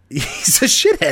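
laughing through the words. Real, not acted. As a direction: chuckle it out as you say it. Laughing while repeating partner.

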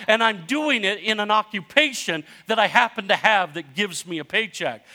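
loud, high pulpit exhortation; fiery preaching. Acted. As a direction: drive it home to the congregation. Preaching climax at full force.

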